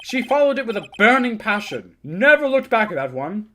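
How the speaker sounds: a hint of a rattled old man ranting; crude old lawyer. Old lawyer ranting about the girl, mildly worked up. Lawyer boasts, reacts loudly.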